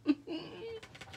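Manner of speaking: bright burst of laughter; a real, delighted laugh. Real, not acted. Amused at her own crafting.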